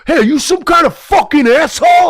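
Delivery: put-on yelling clerk; high, loud mock accusation; angry clerk. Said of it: "guy comes chasing after me and goes".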